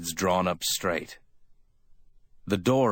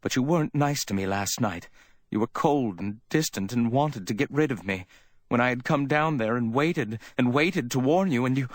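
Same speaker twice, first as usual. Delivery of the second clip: higher, aggrieved woman's voice; aggrieved Iva; 6 semitones higher than usual. A narrator's reading. Woman reproaching her lover for coldness, aggrieved. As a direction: reproach him in a raised, plaintive pitch.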